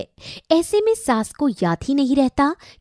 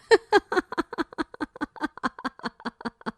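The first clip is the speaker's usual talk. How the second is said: soft closing laugh; 4 semitones higher, 10 dB quieter than usual. Acted. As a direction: laugh it off happily. Closing laugh after song.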